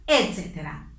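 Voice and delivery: ordinary delivery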